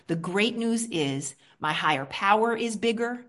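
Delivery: ordinary talk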